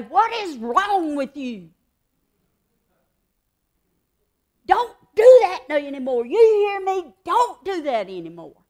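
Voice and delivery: put-on loud, stern scolding of a child; harsh scolding. Shown as a demonstration. Teacher scolding a misbehaving child, loud and harsh. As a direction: bark it at the kid, fed up. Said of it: "you can say it that way".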